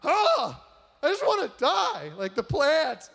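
loud, high mock wail; hammed-up melodramatic outcry; hysterical overreaction. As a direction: wail it like a pretend catastrophe. Exaggerated response to a dying plant.

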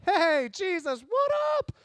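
high, loud breezy hey; mock casual greeting. Preacher mocking a chill hello to Jesus, high and loud. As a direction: hail a buddy across the water.

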